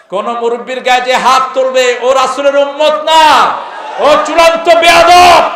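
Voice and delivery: building to a high, loud shout; preaching shout. Real, not acted. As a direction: rise to a thundering warning. Preaching at full force. (ক্রমশ চড়া গলায় হুঁশিয়ারি)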